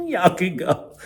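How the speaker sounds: giggly high-pitched get-going; high laughing shoo. Retold or imitated. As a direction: shoo them off through a laugh. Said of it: "And I'm like".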